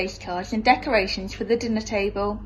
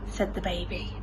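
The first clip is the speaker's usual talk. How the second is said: plain reading voice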